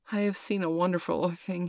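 ordinary delivery, nothing marked

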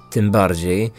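ordinary talk, nothing marked

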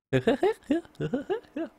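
high, loud put-on clown cackle; badly faked clown laugh. Shown as a demonstration. Gamer trying a clown noise for a friend, badly faked clown laugh. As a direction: force out a silly clown laugh. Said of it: "I can't even do a clown laugh".

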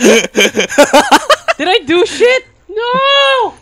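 slow drawn-out anguished cry; despairing wail. Real, not acted. Teammate downed, despairing wail.

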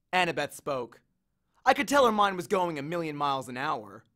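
ordinary delivery

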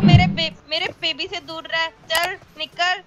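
high-pitched, playful telling-off; possessive warning. Pretend. Warning a rival off her babe.

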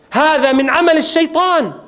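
loud, high cry of horror; shocked outcry. Acted. Musa realizing he has killed a man, loud shocked outcry. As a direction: exclaim it high and forcefully in Arabic.

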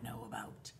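whisper. Acted.

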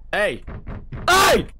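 sudden loud yell, high and loud; shouting hey. Real, not acted. Gamer yelling at an intruder at the door, loud.

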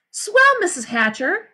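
high, drawn-out eagerness; delighted girl. Acted. Sheila thrilled to be trusted, high eager drawl.

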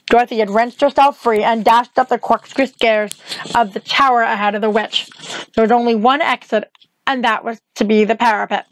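plain reading voice

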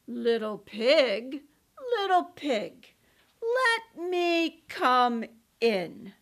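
slow, loud big bad wolf. Wolf at the door.

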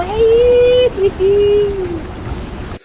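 loud shrill burst of laughter; high shrieking laugh. Real, not acted. Laughs after escape attempt.

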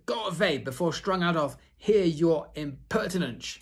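high, loud order to leave; angry ringmaster. Acted. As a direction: shoo the girl off, outraged. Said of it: "said the ringmaster".

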